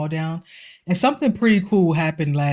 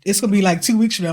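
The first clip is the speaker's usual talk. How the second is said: ordinary talk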